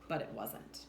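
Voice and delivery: usual speaking voice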